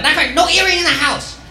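high mock strict mom; mocking a strict mom, no earrings allowed. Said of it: "She's like".

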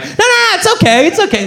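very high-pitched, hurried mock pleading; frantic reassurance. Man begging upset caller to stay, frantic high reassurance. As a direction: squeal it to stop them leaving.

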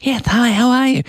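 high, put-on presenter greeting; radio voice. Shown as a demonstration. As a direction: answer the phone as your on-air self. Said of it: "put my radio voice on".

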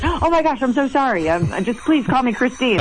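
high, startled and apologetic; flustered, caught not hearing her new title; flustered apology. Retold or imitated. Mayor surprised at being called mayor, flustered apology. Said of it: "I said".

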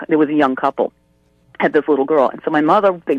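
ordinary talk, nothing marked

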